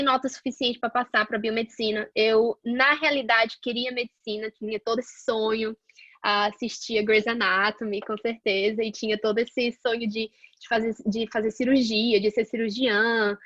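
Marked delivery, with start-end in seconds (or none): none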